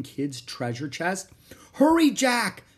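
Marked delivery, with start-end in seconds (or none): 1.7-2.6 put-on high, loud girl's call; urgent cry. A narrator's reading. Girl on the ladder to brother, urgent shouting. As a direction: call out to hurry him. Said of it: "cried Annie".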